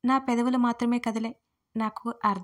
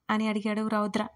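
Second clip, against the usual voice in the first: usual speaking voice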